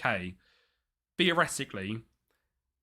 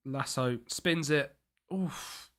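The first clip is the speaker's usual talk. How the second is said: ordinary talk, nothing marked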